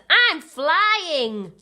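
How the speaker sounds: high, loud, very slow cry of joy; cried. A narrator's reading. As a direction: shout it out with delight. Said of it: "cried Little Bear".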